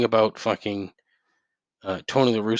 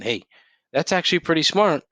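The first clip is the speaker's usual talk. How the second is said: usual speaking voice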